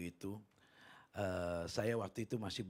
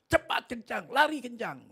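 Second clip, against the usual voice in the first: high, loud urgent shout; retold yell; 12 semitones higher, 10 dB louder than usual. Preacher urges bus driver to flee, high urgent yell. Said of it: "karena saya teriak".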